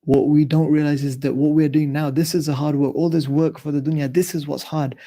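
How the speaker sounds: ordinary talk, nothing marked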